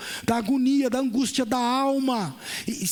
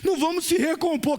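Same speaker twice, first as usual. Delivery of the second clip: high, swelling preacher's call; preaching; 6 semitones higher than usual. Acted. Pastor challenging the church, rising fervor. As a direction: press the point on the church.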